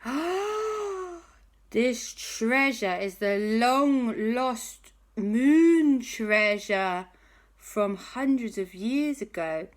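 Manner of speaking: high, slow, marvelling voice; awed wonder. Acted. Hattie in awe of the moon treasure, slow and high. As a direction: draw out the wonder.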